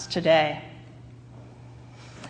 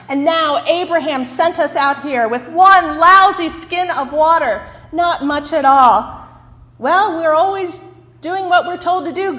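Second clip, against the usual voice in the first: angry at God; 6 semitones higher, 7 dB louder than usual. Acted. Hagar rails at God.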